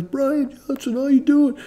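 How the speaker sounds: high-pitched imitation of an eager greeter; excited greeting. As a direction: mimic someone thrilled to run into an old acquaintance. Acting out old acquaintance's eager greeting.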